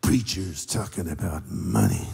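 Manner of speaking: slow, quiet grumble about preachers and money; lightly voiced complaining congregant; mock grumbling churchgoer. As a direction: sulk it out like a reluctant giver. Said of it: "Now we say".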